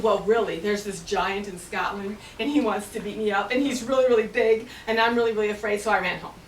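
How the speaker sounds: loud, anxious rush of fear; scared confession. Acted. Finn telling wife about the giant, loud anxious voice. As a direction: blurt out your fear.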